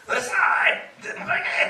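full-voiced shout as the returning heckler; acted-out bellowing; angry yell. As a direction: burst out loud, imitating an irate man. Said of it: "って言われたんですって".

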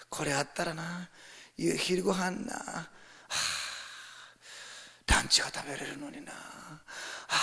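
soft, sighing reluctance; mock regret with breathy sighs between phrases; grudging giver. Reluctant giver mourning a lost lunch, grudging sighs. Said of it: "惜しみながらする人なんです".

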